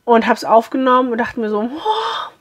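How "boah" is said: high, soft startled boah; mock shocked boah. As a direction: gasp at your own singing. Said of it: "ist mal eine andere Tonart". (hohes, gehauchtes Boah)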